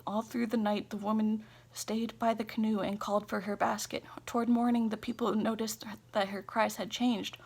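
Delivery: ordinary delivery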